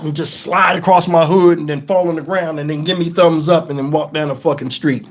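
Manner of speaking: usual speaking voice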